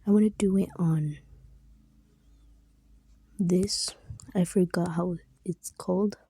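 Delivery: ordinary delivery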